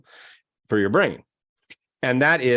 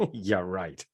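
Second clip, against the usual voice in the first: low, quiet doubting scoff; scoffing brain; 8 semitones lower, 5 dB quieter than usual. Acted. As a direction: brush it off, unconvinced. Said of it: "your brain goes".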